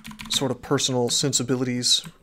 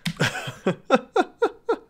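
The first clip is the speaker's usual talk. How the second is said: high-pitched burst of laughter; 12 semitones higher than usual. Real, not acted. Streamer cracking up mid-chat, high laughter.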